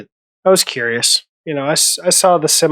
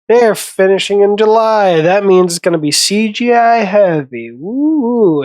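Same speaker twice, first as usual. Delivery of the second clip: imitating an online doomsayer, pitched higher; mock the alarm, swooping up high on the final woo; mock worked-up commenter; 5 semitones higher than usual.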